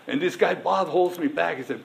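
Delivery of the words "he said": ordinary talk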